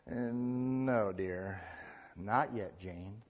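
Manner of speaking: low, soft, measured refusal; patient parent. Acted. Parent turns down teenage daughter, soft and patient.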